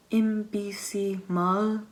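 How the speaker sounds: put-on slow, drawn-out Korean accent. Asking a Korean taxi driver for directions, slow Korean accent. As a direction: say each syllable slowly, as if Korean. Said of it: "with a Korean accent".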